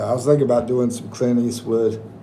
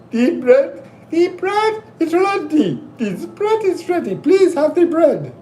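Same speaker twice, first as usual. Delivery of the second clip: female voice; 14 semitones higher than usual. Retold or imitated. Nanny offering bread in a high female Indian-accented voice. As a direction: push it into a high lady's voice. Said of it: "more of a female voice".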